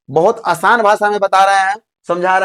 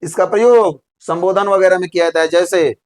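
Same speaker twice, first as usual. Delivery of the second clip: ordinary talk, nothing marked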